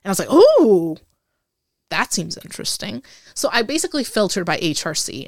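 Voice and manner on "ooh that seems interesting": light up at a risky job offer; excited ooh. Retold or imitated. Excited reply to high-risk option.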